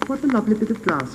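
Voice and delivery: put-on high, fast, delighted child; little girl's cry. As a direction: squeal it out like a little girl. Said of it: "cried the little girl".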